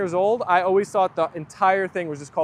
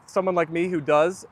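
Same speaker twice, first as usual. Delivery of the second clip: ordinary talk, nothing marked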